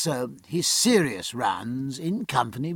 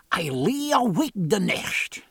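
put-on slow, higher broad Scottish accent; 6 semitones higher, slower than usual. A narrator's reading. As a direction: Scots burr with rolled R's. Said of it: "invaded by his native Scotch".

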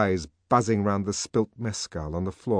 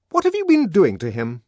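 high, loud, fierce nurse; fierce demand; 10 semitones higher, 11 dB louder than usual. Acted. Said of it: "she demanded fiercely".

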